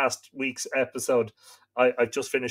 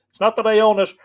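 higher, louder mock protest; joking protest, raise the voice; mock indignant; 8 semitones higher, 7 dB louder, faster than usual. Real, not acted. Joking protest about owning town.

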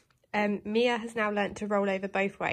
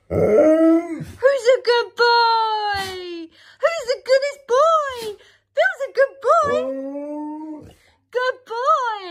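very high, loud squealing praise; doggy praise voice; 11 semitones higher, 8 dB louder, much slower than usual. Real, not acted. Praising the dog.

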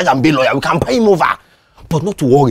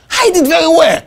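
normal talking voice

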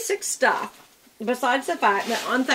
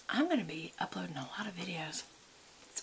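whisper; 2 semitones lower, 12 dB quieter than usual. Real, not acted. Aside whisper.

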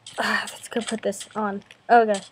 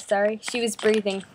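ordinary delivery

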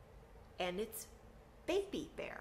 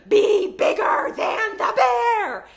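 much higher, much louder cry; frantic yell; 14 semitones higher, 18 dB louder, slower than usual. Retold or imitated. Mom yelling at her kids as she flees the bear, a much higher, louder frantic scream. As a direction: scream the order while bolting. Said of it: "my mom goes".

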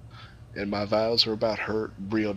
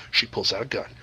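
ordinary talk, nothing marked

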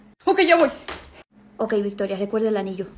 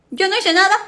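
indignant protest; 6 semitones higher, 5 dB louder than usual. Acted. Niña regañada protesta.

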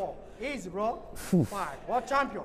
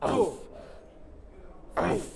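normal talking voice

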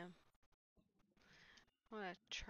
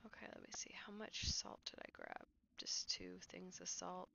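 whispering. Real, not acted. Late night, keeping voice down.

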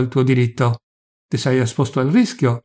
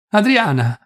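high, falling call of a name; 8 semitones higher than usual. A narrator's reading. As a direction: call her name on a raised pitch. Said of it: "chiamò".

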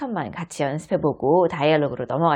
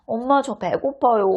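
put-on child voice; 7 semitones higher than usual. Child says hungry.

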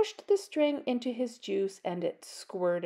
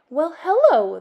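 smiling greeting; 5 semitones higher, 10 dB louder than usual. Acted. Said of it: "the teacher smiled at him".

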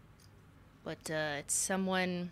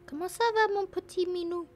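cooing to a cat; 10 semitones higher than usual. Real, not acted. Owner asking her little cat how it is, cooing in French. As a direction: coo in French, pitched way up.

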